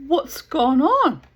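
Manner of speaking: loud, high, drawn-out demand; alarmed questioning. Retold or imitated. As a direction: act out slow, emphatic disbelief. Teller re-enacts demanding an explanation.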